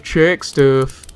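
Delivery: slow, loud exaggerated Southern drawl on a service order; exaggerated southern accent. Mocking a lazy service advisor's order in exaggerated Southern accent. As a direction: ham up a Southern accent, stretching it out. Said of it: "Exaggerated southern accent".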